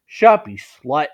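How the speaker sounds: loud hissy snap back; snapped. Acted. Spider woman snapping at Rias, loud and spiteful. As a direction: bite the words off at her. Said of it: "She snapped".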